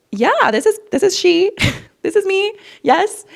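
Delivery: high-pitched, flustered mock phone voice; flustered phone answer. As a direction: act out a high, awkward, giggly reply. Acts out answering unexpected call.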